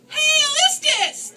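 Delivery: tiny voices hailing a guest together; declared. A narrator's reading. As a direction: ceremonial unison cry. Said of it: "declared the mice".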